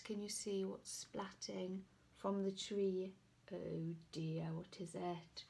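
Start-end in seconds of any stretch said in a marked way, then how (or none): none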